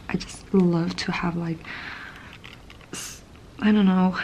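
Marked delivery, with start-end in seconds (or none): none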